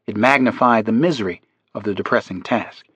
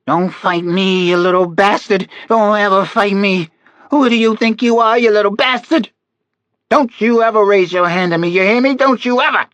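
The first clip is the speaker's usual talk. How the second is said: high, loud enraged crone; furious old woman; 10 semitones higher, 4 dB louder than usual. A narrator's reading. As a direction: scream at the child in rage. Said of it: "a very old woman's voice".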